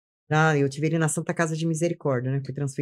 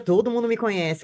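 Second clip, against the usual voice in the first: ordinary talk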